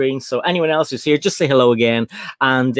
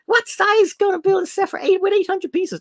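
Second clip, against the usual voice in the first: much higher, squeaky disbelieving voice; mock chat freak-out. Host voices the chat reacting to the piece count, high mock disbelief. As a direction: play the shocked viewers, pitched way up. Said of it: "people in the chat are freaking out, going".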